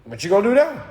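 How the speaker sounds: high, loud teasing singsong; mock taunting teacher. Teacher taunting the failed student, high and mocking. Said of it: "And I'm just like".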